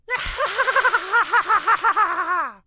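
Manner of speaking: high, loud, shrieking clown cackle; evil clown laugh. Pretend. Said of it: "do our own evil clown laugh".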